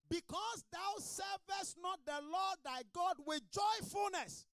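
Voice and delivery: slow, high shouted scripture; full-force preaching. Real, not acted. Preacher cries the next verse to congregation, full force.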